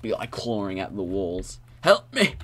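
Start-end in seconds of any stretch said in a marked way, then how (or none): 1.8-2.3 loud, high plea for help; playful shout of help; mock cry for help. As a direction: yell it like you're trapped in a maze. Imagines being trapped in a maze.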